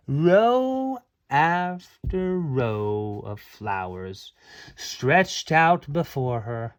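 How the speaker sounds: usual speaking voice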